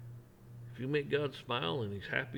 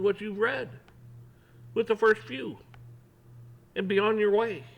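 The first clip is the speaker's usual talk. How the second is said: scolding disbelief; 8 semitones higher, 4 dB louder than usual. Retold or imitated. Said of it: "And he's like".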